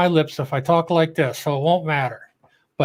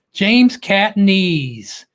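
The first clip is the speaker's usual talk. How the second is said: very slow, higher, louder name shout-out; drawn-out welcome call; announcer call-out; 4 semitones higher, 4 dB louder, much slower than usual. Acted. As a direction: stretch out the channel name like a ring announcer. Host calls out viewer's name.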